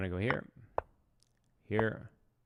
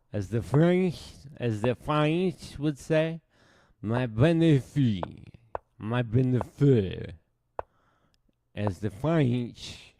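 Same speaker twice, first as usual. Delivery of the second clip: hammed-up French accent, higher and slower; mock French accent; 4 semitones higher, 6 dB louder, slower than usual. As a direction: play a jokey Frenchman savoring the word. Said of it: "that's my very good French accent".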